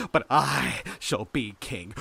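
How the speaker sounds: loud, slow, drawn-out claim; slow, emphatic proclamation; grand declaration. A narrator's reading. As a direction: stretch it out with mad conviction. Mad claim to the throne.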